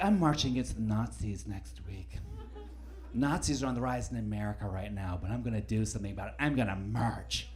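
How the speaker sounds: quiet, low mock activist; lightly voiced self-righteous woman. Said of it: "She's like".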